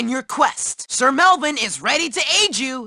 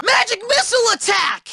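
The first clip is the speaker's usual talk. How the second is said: fast, high battle cry; spell battle cry; 6 semitones higher, faster than usual. Acted. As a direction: call out your attack. Calling out a spell in play.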